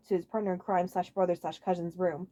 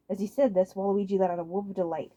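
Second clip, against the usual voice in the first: plain reading voice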